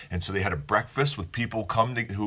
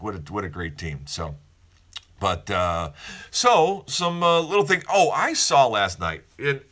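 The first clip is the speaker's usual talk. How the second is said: ordinary talk, nothing marked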